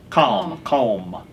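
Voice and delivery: CALM said slowly and distinctly; CALM enunciated, dropping low on the repeat; clarifying a word. Shown as a demonstration. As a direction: repeat CALM carefully for someone who misheard. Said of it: "What is a calm voice?".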